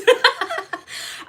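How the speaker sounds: high burst of laughter; real high-pitched giggling. Real, not acted. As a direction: laugh out in a quick high run. Laughs at own remark.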